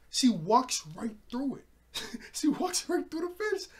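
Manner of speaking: loud, high, laughing disbelief; incredulous, pitch leaping up as you break into laughter; amazed. Real, not acted. Amazed at the plot turn.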